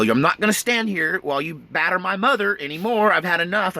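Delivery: defiant stand. Retold or imitated.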